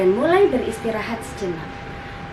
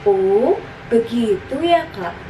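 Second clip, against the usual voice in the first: put-on understanding child, nodding along; 4 dB louder than usual. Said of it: "seolah sudah paham betul".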